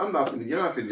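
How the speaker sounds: ordinary delivery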